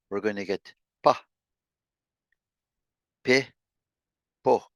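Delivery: "pa", "Pe", "po" said clearly; short vowel syllables. Shown as a demonstration. Teacher drilling short-vowel syllables with p. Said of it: "when we add the ah sound".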